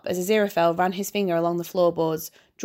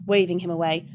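ordinary delivery, nothing marked

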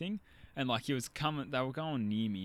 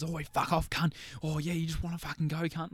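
mild mock yell, pitched up; retold screaming; 4 semitones higher than usual. Retelling a shoplifter picking a fight, mild mock yell. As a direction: imitate his screaming at half strength. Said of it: "He was screaming. He goes".

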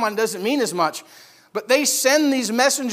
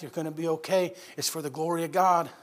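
soft, lowered bedside comfort; soothing reassurance; 3 semitones lower, 8 dB quieter than usual. Acted. Sisters to bedridden Lazarus in his fever, soothing reassurance. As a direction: gently settle the patient with quiet assurance.

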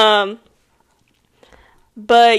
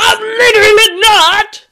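higher, louder sobbing protest; crying shout; 12 semitones higher, 12 dB louder, slower than usual. Acted. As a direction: wail it like a hurt teen.

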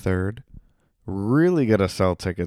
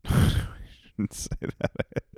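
laughing; slower than usual. Real, not acted. Laughs at own dark joke.

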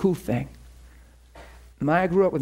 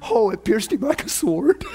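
laughing exclaim. Real, not acted. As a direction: whoop it out through laughter. Triumphant laugh at the punchline.

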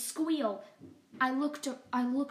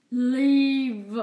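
loud, drawn-out menacing order; menacing command; 13 dB louder than usual. Acted. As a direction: stretch it out as a threat. Rat King repeats order.

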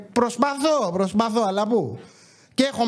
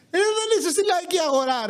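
incredulous snap; 10 semitones higher than usual. Acted. Snapping at the old man.

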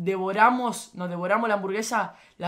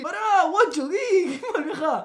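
high, stunned no, pará; blurting out in disbelief; shocked; 7 semitones higher, 3 dB louder, slower than usual. Retold or imitated. Retelling his shock to the girl's request, high and stunned.

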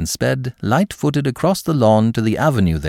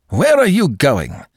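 stern demand; 6 semitones higher, 5 dB louder than usual. Acted. Uncle stopping Aline.

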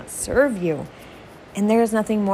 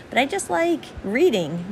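higher-pitched objecting listener; mild mock protest, pitched up; imagined listener; 5 semitones higher than usual. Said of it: "you might be like".

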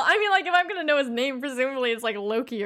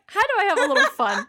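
imagined playful author; 9 semitones higher, 7 dB louder, faster than usual. Pretend. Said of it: "He was probably just like".

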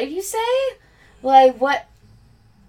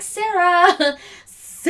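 calling out; 8 semitones higher than usual. Retold or imitated. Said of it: "she's like".

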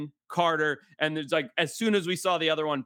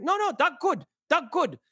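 very high, broken-English mock reassurance; insistent, pitched-up fixer voice; imitating the fixer; 13 semitones higher than usual.

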